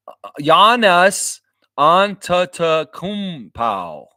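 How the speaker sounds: Giannis Antetokounmpo drawn out very slowly and loud; careful practice pronunciation. Shown as a demonstration. Streamer practising after chat corrected him, slow and deliberate. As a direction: practise the name syllable by syllable. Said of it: "I'll try and say that better".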